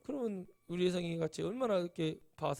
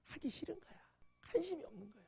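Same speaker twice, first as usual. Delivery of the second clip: hushed whisper; 8 semitones higher, 10 dB quieter than usual. Real, not acted. Preacher whispers the point to the congregation.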